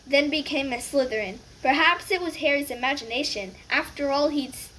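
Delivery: usual speaking voice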